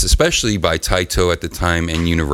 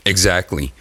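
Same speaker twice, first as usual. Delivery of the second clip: usual speaking voice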